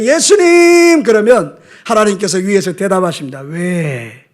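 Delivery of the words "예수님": high, drawn-out, loud call; crying out to Jesus. Acted. God's child calls out to Jesus, long raised shout. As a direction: shout it upward and hold it. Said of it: "소리 지르며".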